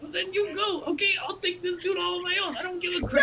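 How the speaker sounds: high, heated yelling; angry shouting. Acted. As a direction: yell in defiance. Cole shouting at Lilith.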